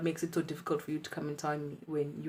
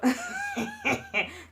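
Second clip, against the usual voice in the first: nervous high laugh. Real, not acted. Nervous laugh before confession.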